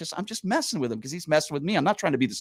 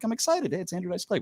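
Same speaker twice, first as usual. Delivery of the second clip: excited; 6 semitones higher, 4 dB quieter than usual. Retold or imitated. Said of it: "and I'm like".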